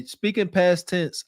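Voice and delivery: normal talking voice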